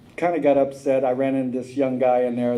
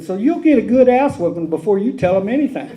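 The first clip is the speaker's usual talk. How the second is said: loud, higher threat with laughter; high, louder laughing warning; 9 semitones higher, 8 dB louder than usual. Retold or imitated. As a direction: threaten her while laughing.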